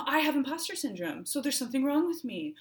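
ordinary talk, nothing marked

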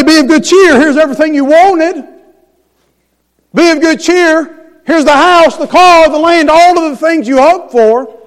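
high, loud, fervent preaching; preaching at full force. Acted. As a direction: call it out high and loud like a promise. Preacher mocking what Jesus didn't say.

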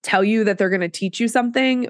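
normal talking voice

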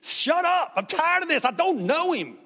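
high, heated outburst; emphatic, exasperated denial. Acted. Peter fed up with being asked about Jesus, emphatic denial. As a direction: lash out at whoever keeps asking. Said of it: "with emphasis".

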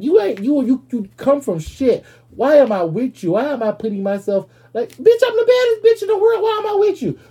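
very high, loud scolding of a worthless ex; high squealed diva fury; shrieking mock rant. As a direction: screech it at the ex, outraged. Acted rant at an ex.

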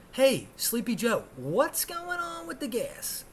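high-pitched, incredulous demand; mock-indignant holler; exasperated call-out. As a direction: call out the president in drawn-out, high-pitched disbelief. Mock calling out the president.

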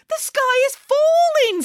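very high, slow wail of alarm; high-pitched, drawn-out storybook panic; panicked cry. A narrator's reading. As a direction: cry out like a hysterical little chicken. Chicken Little repeats the alarm.

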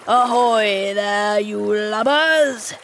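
loud, long-drawn pirate captain's hail; imitated Hook. A narrator's reading. Peter fooling the pirates as their captain, commanding call. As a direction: call out slowly, stretching every word. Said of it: "he imitated the voice of Hook".

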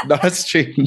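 saying it through a real laugh. Real, not acted. Guest laughing as he corrects the host's word. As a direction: laugh while setting the record straight.

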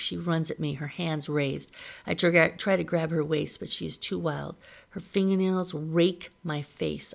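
usual speaking voice

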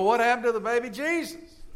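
high, loud alarmed question; a pastor staring at the empty manger; shocked thought. Retold or imitated. Pastor finding the manger empty, alarmed high question. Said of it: "He thought".

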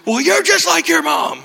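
high, snippy jab at a spouse; snippy accusation. Retold or imitated. Quarrel with spouse, high snippy jab. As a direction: throw the line like an accusation. Acted bad reaction in quarrel.

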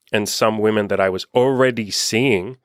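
ordinary delivery

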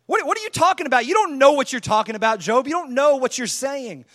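loud, high-pitched incredulous scolding; acted exasperated outburst; God going hard. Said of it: "he just goes hard on him".

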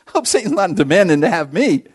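normal talking voice

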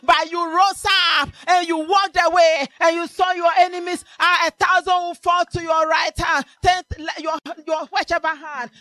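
loud, shouted sermon over music; full-force preaching. Acted. Preacher shouting to the congregation, full force.

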